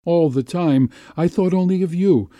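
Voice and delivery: high, impassioned outpouring; anguished confession. A narrator's reading. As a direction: confess it with aching urgency. Anguished lover pours out her feelings.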